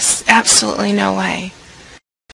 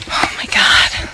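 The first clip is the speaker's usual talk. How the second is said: slow nervous whisper; hushed slow whisper; slower than usual. Acted. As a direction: breathe it out slowly. Nervous alone at home.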